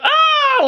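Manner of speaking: high, sharp imitated yelp; mock scream. To viewers, mimicking the thief's pained scream. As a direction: squeal like the thief losing his nose.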